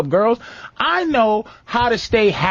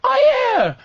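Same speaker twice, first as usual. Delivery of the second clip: much higher, squeaky mock-girly voice; hammed-up impression of his girlfriend insisting; imitating girlfriend; 10 semitones higher than usual. Retelling girlfriend's TV-night reply, mock high and insistent. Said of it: "and she goes".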